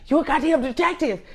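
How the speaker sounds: teasing accusation; exasperated Ian. Retold or imitated. Mother imitating Ian calling her a detective, high and exasperated. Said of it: "he'd be like".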